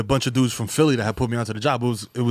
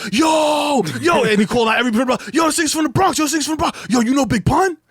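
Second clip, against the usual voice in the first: high, loud, hyped-up yelling of yo; calling out to everyone, thrilled; excited Philly homie; 11 semitones higher, 4 dB louder than usual. Retold or imitated. Philly dude at the warehouse, hyped to meet a Bronx guy. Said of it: "He's like".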